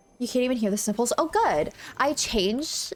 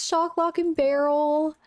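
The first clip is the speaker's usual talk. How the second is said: excited; 6 semitones higher, slower than usual. Real, not acted. Gushing over the cute shirt.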